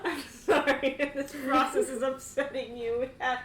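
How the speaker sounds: stifling real laughter as the polite guard; laughing through lines. Real, not acted. GM voicing guard to the furious noble, laughing. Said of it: "I'm gonna cry".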